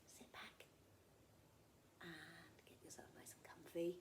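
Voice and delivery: soft hushed invitation; whisper. Acted. Storyteller whispering to children to get comfy. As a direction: whisper them into their seats. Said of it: "settling children for story".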